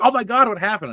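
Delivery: mock alarmed mother; mom's alarm. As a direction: voice his worried mother. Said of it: "She's like".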